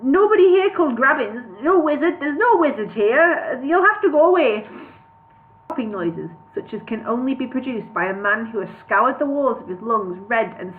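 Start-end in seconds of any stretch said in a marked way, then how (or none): 0.0-4.7 high, louder, agitated protest; flustered gabble. A narrator's reading. Bingo trying to send the dwarfs away, high flustered protest. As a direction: pitch it up and plead in a fluster. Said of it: "Bingo gabbled at them".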